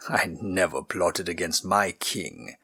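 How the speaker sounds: a prisoner denying treason gravely; serious nobleman. A narrator's reading. Prisoner assures the girl, low and serious. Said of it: "he said seriously".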